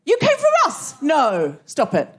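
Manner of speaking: delighted surprise. Real, not acted. Host thrilled the couple flew in for the show, squealing in surprise.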